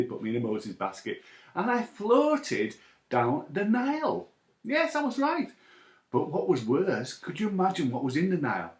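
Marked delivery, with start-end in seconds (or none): none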